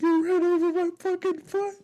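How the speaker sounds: high-pitched comic outrage, near tears; mock freak-out. Imitating brother about to cry over his foot, mock freak-out. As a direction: squeal the accusation like an upset kid. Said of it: "he was freaking out".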